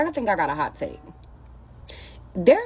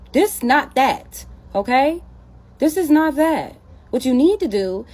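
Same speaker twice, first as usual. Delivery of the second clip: sassy emphasis; 8 semitones higher, 4 dB louder than usual. Real, not acted. Emphatic correction to viewers.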